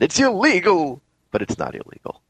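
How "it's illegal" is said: higher, mock-outraged announcement; mock proclamation. Host quoting the columnist's false claim, mock proclamation. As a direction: declare it like an alarmed headline. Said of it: "he published and said".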